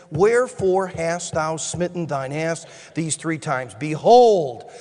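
low, solemn Bible reading; solemn scripture read. Acted. Reads scripture.

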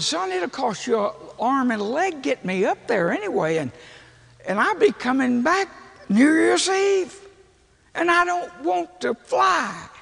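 high-pitched, building excuse-making; reluctant protest. Retold or imitated. Acts out his reluctant phone reply.

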